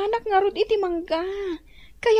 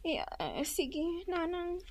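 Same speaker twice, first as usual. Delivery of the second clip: much softer, hesitant yes; timid mumble; 15 dB quieter, slower than usual. Acted. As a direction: mumble meekly to mother. Caught idle by mother.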